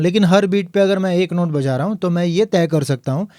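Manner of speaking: usual speaking voice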